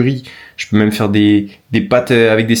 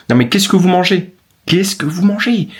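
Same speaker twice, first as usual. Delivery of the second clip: indignant disbelief; 7 semitones higher than usual. Real, not acted. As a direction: ask in disbelief. Indignant rant at viewers.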